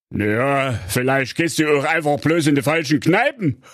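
hohe, aufgebrachte Königsstimme; wütender König. Acted. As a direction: schimpfender Märchenkönig, der seine Tochter zurechtweist. König spottet. (der König schimpft empört mit seiner Tochter)

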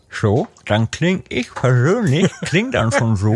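talking around a cork; words muffled by a cork; cork in mouth. Real, not acted. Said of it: "so einen Korken in den Mund nehmen".

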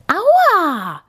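a sharp, outraged ouch; startled boar girl cries out after a bump; indignant ouch. A narrator's reading. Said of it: "rief sie empört".

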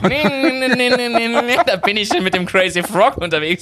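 high, laughing denial. Real, not acted. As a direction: protest through laughter.

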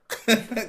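short, high, loud laugh; quick laugh. Real, not acted. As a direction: burst out laughing. Laughs at Maybach dream.

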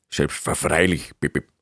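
eager odd little helper. A narrator's reading. Assistant obeys the beaver master, in an odd beeping creature voice. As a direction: drag out the word, then beep twice. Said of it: "riefen und sich umgehend in Bewegung".

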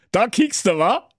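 high, sneering king voice, snatching the paper; mocking king. King taunts Schnitzelschorsch, high sneering voice.

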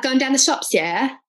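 put-on teasing take-off of a London-area English accent; mock English accent. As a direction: poke fun at the English accent on the bus. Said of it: "the accents would just do my head in".

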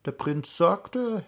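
ordinary delivery, nothing marked

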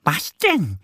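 a curious little duck; little duck Annie. A narrator's reading. Annie asking the squirrel, lightly voiced duckling. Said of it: "antwortet Annie".

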